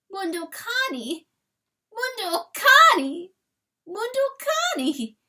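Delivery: high whine rising each time; childlike whine. A narrator's reading. Chanticleer whining for his dead dog like a child, very high. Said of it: "he whined high in his throat, a child".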